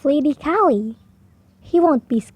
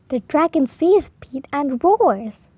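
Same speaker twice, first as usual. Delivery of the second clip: ordinary delivery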